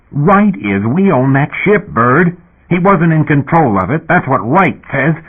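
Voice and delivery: a touch of a yell, slow and insistent. A narrator's reading. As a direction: insist hard. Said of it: "He was yelling".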